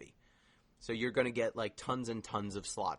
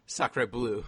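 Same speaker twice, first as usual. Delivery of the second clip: a burst of mock French, louder; tossing out Pierre's French accent; 7 dB louder than usual. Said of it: "every time Pierre talks, I laugh".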